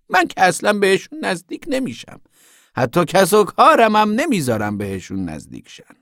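high, loud, drawn-out scolding; zealous moralizing woman. A narrator's reading. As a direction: preach at her in outraged slow emphasis. Voices the preachy woman.

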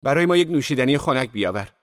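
high, carrying call; loud, clear voice. A narrator's reading. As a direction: project the order across the room. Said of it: "دیزی با صدایی رسا گفت".

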